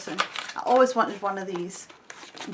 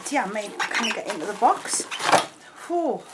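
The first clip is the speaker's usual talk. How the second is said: out of breath; 3 dB louder than usual. Real, not acted. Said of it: "I'm out of breath".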